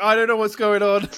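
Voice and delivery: high, loud flustered panic; panicked exclaiming. Retold or imitated. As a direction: blurt it out, totally lost. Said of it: "I was like".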